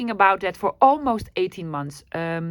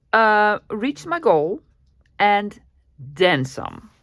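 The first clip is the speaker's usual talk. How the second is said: drawn out, savoring the news; slow, proud reveal of the milestone; slower than usual. Real, not acted. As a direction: let the words land slowly. Proud reveal of reaching goal.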